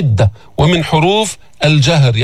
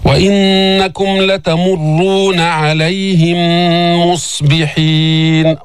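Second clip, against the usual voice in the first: slow, sustained tajweed recitation; Quran recitation; 2 semitones higher, much slower than usual. Shown as a demonstration. Teacher recites the verse for learners, slow recitation. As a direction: hold the hums and long vowels.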